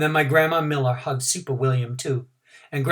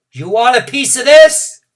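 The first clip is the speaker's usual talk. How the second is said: mad shout; 10 semitones higher, 12 dB louder than usual. A narrator's reading. Girl fisting at room eight, angry shout. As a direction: challenge shouted much higher and louder. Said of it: "I shouted real mad".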